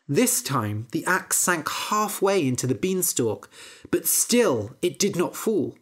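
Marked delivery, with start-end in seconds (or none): none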